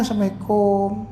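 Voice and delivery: unhurried, courteous salam; polite greeting. Shown as a demonstration. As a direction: greet politely at an easy volume. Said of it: "intonasi so". (salam dengan intonasi sopan)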